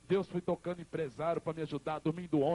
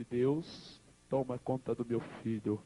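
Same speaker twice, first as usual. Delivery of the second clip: tearful prayer; 6 semitones lower, slower than usual. Retold or imitated. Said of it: "ela ali chorando".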